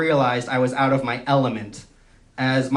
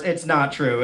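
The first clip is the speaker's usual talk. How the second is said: ordinary talk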